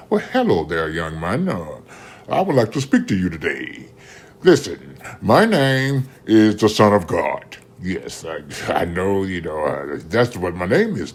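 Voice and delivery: stilted, over-proper divine greeting; mock voice of God. Mocking how people think the Lord spoke, stilted God voice. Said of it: "God don't talk like that".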